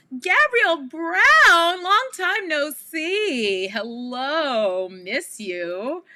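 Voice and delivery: high, drawn-out, loud hello; excited greeting. Real, not acted. Host hailing a familiar viewer who just joined, excited greeting. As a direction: sing out the greeting, stretching every word. Greeting someone joining the stream.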